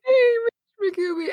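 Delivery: high squeal of the name. Pretend. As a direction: squeal it out, gushing. Repeating the silly name.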